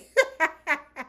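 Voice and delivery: big amused laugh out loud. Real, not acted. Host cracking up at the recap, loud laugh. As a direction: burst out laughing.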